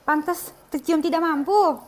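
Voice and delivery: loud slow snobby jab; snobby sneer. Acted. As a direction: look down on them. Rich girl insults poor viewer.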